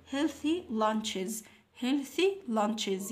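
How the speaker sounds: very slow, drawn-out English model words; slow model reading. Shown as a demonstration. Teacher reading the unit title to second graders, very slow. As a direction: say it slowly so the kids repeat.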